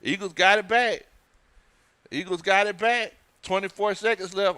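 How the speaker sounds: high, loud, elated calls; excited play call. Real, not acted. Watcher calling his team's recovery, excited celebration. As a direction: celebrate the turnover as it happens.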